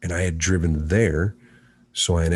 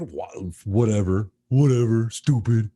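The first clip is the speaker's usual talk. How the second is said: dismissive quote; 6 dB louder than usual. Retold or imitated. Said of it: "I'm like".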